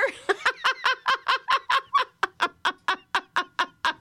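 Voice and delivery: very high, loud peals of laughter; big laugh. Shown as a demonstration. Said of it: "And a little louder".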